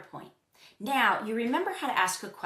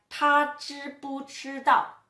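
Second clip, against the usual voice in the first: slow, loud, higher-pitched Mandarin example; slow model question; 6 semitones higher, 6 dB louder, slower than usual. Shown as a demonstration. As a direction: project each syllable slowly for learners. Teacher models a Chinese example question.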